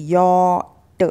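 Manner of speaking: यॉट slowly split as YAW-t; correct pronunciation. Shown as a demonstration. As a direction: stretch यॉट, then release the T. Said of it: "यॉट बोलना है". (यॉट का सही उच्चारण, धीरे से)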